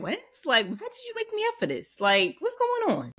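high-pitched, put-upon "why wake me" voice; mock incredulous. Jesus to the disciples who woke him, comically incredulous. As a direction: complain in disbelief, voice jumping high. Acts Jesus woken up in annoyance.

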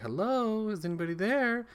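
lightly voiced mom, high calling hello; a mother calling for her kids in the dark, high mild call. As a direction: call high into an empty house.

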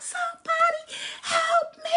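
high-pitched, loud cry for help; acted shriek of someone in terror; panicked screaming. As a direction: scream desperately for rescue. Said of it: "screaming for help".

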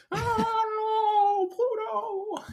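tiny squeaky cartoon cry; Mickey Mouse voice. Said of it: "sounds kinda like Mickey Mouse".

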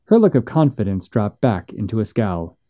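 plain reading voice

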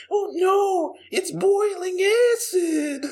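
very high, slow cartoonish shriek; goofy guard panic. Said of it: "there's that goofy guard".

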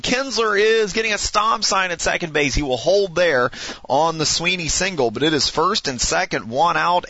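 lively sportscaster calling the action; play-by-play announcer. Acted. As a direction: call it like a radio broadcaster. Announces the simulated game.

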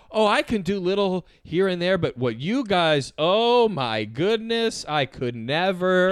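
long-drawn swooping humblebrag; milk the modesty, dragging out each phrase; mock false modesty. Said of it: "Classic guy like this who's like".

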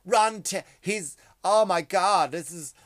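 high, loud mock woman, slowed; hammed-up fangirl panic, drawn out; mock woman's voice. Comic voicing women fans online, high loud mock panic. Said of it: "This is a woman's voice".

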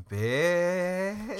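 high, drawn-out catty reaction; mock disbelieving stare. Bunkmates stare back at Sarah, mock catty disbelief. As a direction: stretch the one word upward, campy.

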